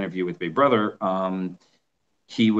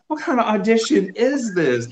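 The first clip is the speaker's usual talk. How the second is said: incredulous; 13 semitones higher than usual. Retold or imitated. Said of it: "And I'm like".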